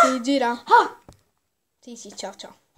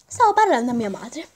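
ordinary talk

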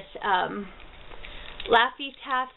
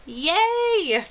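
excited cheer; 8 semitones higher, 3 dB louder than usual. Real, not acted. Cheering over a gift.

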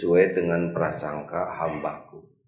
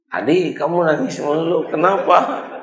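high, loud teasing question; mother puzzled by the crying boy; concerned mother; 9 semitones higher, 5 dB louder than usual. Acted. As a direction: ask him why he keeps crying.

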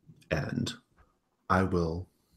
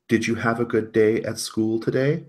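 high, doting mom question; artificial motherly interest; 6 semitones higher, 3 dB louder than usual. Acted. Serena asks Ellen about school, artificially sweet. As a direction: ask with put-on motherly interest. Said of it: "she says".

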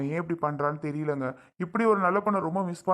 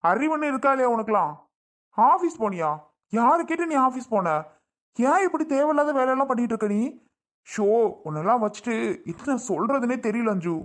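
raised, high-pitched exasperated rebuke; angry scolding; 4 semitones higher than usual. Acted. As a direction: tell off your sister in exasperation. அண்ணன் தங்கையைத் திட்டுகிறான். (கோபமாகத் திட்டுதல்)

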